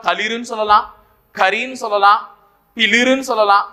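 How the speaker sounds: ordinary delivery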